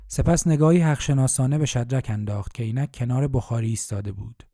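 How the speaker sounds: ordinary delivery, nothing marked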